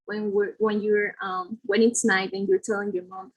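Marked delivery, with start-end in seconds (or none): none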